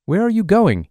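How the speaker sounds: a man running toward Amy, shouting. Retold or imitated. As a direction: yell the question at someone far off. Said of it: "he shouted at her".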